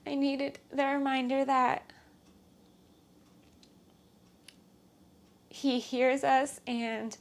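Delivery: quietly tearful thanks; teary. Real, not acted. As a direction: still welling up, voice catching. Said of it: "I don't know why I'm crying now".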